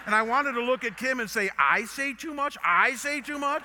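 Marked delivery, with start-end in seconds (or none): 1.6-3.6 high, loud mock indignation; hammed-up disbelieving question; incredulous retort. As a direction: throw the accusation back, loud and high. Mock retort to wife, turned tables.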